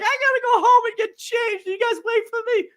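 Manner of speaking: high whiny plea; mock a pleading kid, high-pitched; whiny friend. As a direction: friend begging the group to wait, high. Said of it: "he's like".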